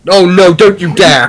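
a loud, startled no, don't you dare; alarmed outburst. Acted. Player stopping a friend's pickpocket attempt, loud and alarmed. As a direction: shout to stop her before she does it.

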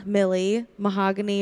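usual speaking voice

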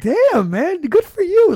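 high, excited mock congratulations; acting out amused admiration for the groom; mock-impressed praise. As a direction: hype him up like you're impressed. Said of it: "I was just like".

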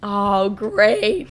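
ordinary talk, nothing marked

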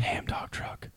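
a secret whisper; whispered idea. Pretend. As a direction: breathe it like a big secret.

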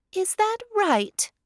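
high, slow curious hare. A narrator's reading. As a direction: ask it as a perky little hare. Hare voiced by narrator.

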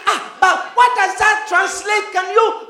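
high, loud, urgent questioning; hammed-up worried patient; pleading mock panic. Patient presses the doctor for plain words, high and anxious.

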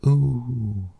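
high squeaky ooh; a startled high ooh; Piglet's high ooh. A narrator's reading. Piglet flying up.

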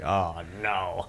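soft, sinking dismay; quiet acted-out horror; hushed dread. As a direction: murmur it as doom approaches. Reacting to the looming killing.

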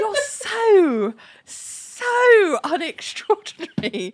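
slow, high, laughing through it; mock her bluntly while cracking up; laughing mockery. Teasing Eleanor by imitating her, slow and laughing. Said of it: "the way you were like".

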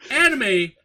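high, slow, laughing outraged rant; mock outrage. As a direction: rant like it's unfair it's this good. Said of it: "and I was like".

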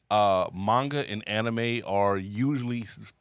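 ordinary talk

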